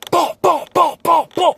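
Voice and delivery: loud high shouted bah bah gunshots; imitated gunfire. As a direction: spit out each shot like a pistol firing. Imitating gunfire out back window.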